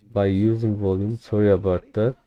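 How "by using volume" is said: ordinary delivery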